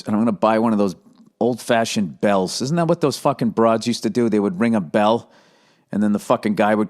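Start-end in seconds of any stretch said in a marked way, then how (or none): none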